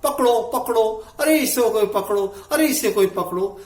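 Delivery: chasing man calls for help; a man running behind yells to catch the other; calling out loudly. Retold or imitated. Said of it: "जोर जोर से चिल्ला रहा था".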